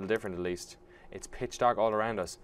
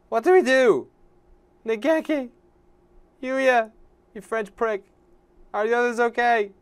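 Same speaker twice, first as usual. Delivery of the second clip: high, raised voice; anxious calling out; 10 semitones higher, 5 dB louder than usual. Acted. Character calling to friends in the dark, high urgent call. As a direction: call out for missing companions.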